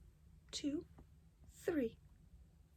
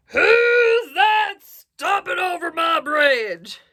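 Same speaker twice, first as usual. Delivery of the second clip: loud, high, slow roar; troll voice; 8 semitones higher, 13 dB louder, much slower than usual. A narrator's reading. Troll challenging the biggest goat, drawn-out roar. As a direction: bellow it with every word stretched. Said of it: "said the troll".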